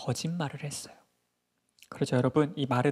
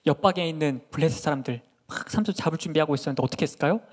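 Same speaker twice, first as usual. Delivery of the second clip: ordinary delivery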